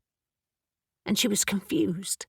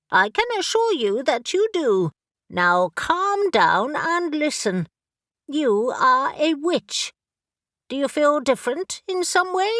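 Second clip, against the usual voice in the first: brisk, impatient talking raven; a raven who has searched everywhere and is exasperated; bossy raven; 7 semitones higher than usual. Acted. Raven character voice.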